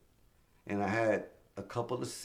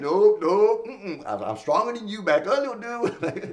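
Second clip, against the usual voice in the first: higher, louder, smug taunt; teasing taunt up high; cocky taunting; 10 semitones higher, 7 dB louder than usual. Retold or imitated. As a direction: brag that you're stronger. Wrestling host for mic.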